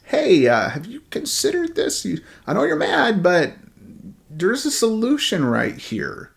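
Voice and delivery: soft, gentle servant's plea, pitch rising on "have you considered this"; respectful, soft. Retold or imitated. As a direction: coax him gently, careful not to anger. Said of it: "in a very respectful way go".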